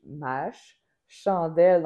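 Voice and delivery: «mâche» «chandelle», the ch glued onto ma, held; ch pulled forward. Shown as a demonstration. Teacher showing the ch pulled onto the first syllable. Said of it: "je devance le, le ch".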